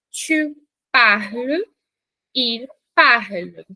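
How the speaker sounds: "Tu parles, il parle" spoken very slowly and clearly; careful teacher model; slow conjugation demo. Shown as a demonstration. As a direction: spell out each form slowly. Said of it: "Repete comigo".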